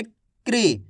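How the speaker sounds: क्री said fast, k gliding straight into ri; teacher's quick क्री as one syllable; quick kri. Shown as a demonstration. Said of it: "इस तरीके से तेजी के साथ आपको उच्चारण करना है".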